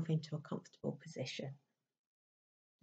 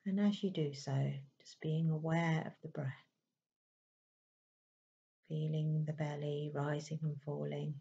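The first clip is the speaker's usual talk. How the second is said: calm, unhurried meditation voice with long pauses; even, settled delivery in short, spaced phrases; guided meditation; 3 dB quieter than usual. Acted. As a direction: gently guide them inward, leaving space between phrases.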